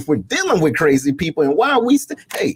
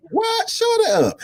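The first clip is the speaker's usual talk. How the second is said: high, squeaky "What? Shut up."; mock disbelief; slower than usual. Host mocking a beggar with feigned shock. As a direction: fake amazement to dodge the ask. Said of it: "So I'm saying".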